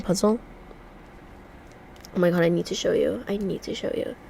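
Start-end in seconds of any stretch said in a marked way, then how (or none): none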